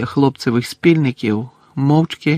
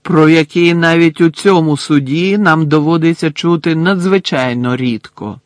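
louder, drowning the son out; raised voice; 4 semitones higher, 6 dB louder than usual. A narrator's reading. Crouch raising his voice over his son. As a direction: speak over the boy. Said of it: "підвищив голос Кравч".

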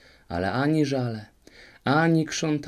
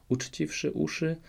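usual speaking voice

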